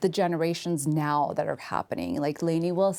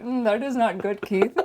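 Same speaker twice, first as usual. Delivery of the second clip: playful, slightly higher put-on Indian accent; mock Indian accent; 3 semitones higher than usual. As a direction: tease her in a joking Indian accent. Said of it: "I just end up sounding like very Indian".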